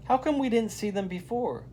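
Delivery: put-on a kid amazed at red creatures; lightly voiced surprised girl. A narrator's reading. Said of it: "says Jyoti".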